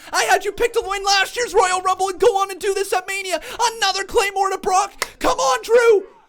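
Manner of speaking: losing it as the title changes; ecstatic shouting. Real, not acted. Title win moment, live reaction.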